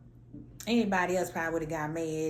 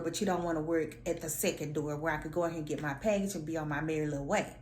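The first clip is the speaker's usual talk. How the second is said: ordinary talk, nothing marked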